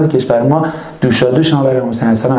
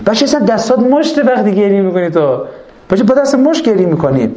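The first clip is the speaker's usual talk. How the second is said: questioning listener; 6 semitones higher than usual. Acted. Voicing a questioner.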